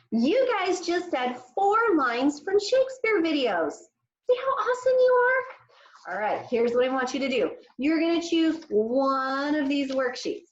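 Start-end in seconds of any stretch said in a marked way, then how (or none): 0.1-6.1 excited praise. Acted. Praising young pupils.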